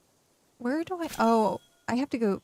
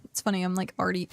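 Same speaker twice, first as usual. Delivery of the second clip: ordinary delivery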